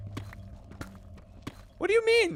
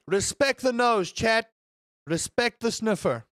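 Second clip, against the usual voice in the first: loud demand; 4 semitones higher, 5 dB louder than usual. Real, not acted. Demands chat respect the sniffer.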